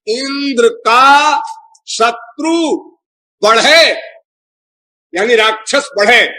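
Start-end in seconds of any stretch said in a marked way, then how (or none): 0.1-4.0 slow, separated words with drawn-out endings; emphatic word-by-word gloss. Shown as a demonstration. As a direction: spell out the meaning slowly, phrase by phrase. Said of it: "मंत्र का अर्थ तो ये था".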